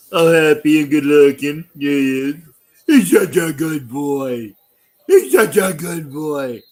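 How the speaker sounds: doting dog-owner voice. Shown as a demonstration. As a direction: coo loudly at him like he's a puppy. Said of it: "That's how I talk to him".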